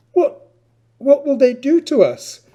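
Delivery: timid Jarvis. Acted. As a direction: squeak it out in mock alarm. Frightened boy Jarvis asks.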